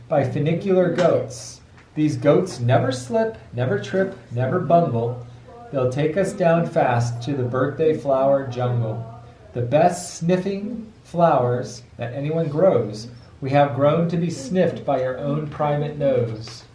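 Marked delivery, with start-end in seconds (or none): none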